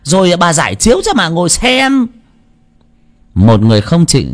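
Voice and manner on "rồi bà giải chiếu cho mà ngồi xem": put-on high sharp old woman's voice. A narrator's reading. Old woman taunting the bystanders, high and sharp. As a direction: voice the quarrelsome old woman, pitched up.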